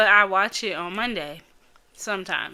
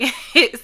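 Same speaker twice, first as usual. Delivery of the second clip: ticklish giggle burst; 4 semitones higher, 8 dB louder than usual. Retold or imitated. Ticklish massage retold.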